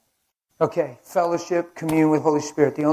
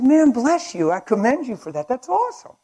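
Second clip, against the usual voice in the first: excited thanks; 9 semitones higher, 7 dB louder than usual. Retold or imitated. Thanking the track giver.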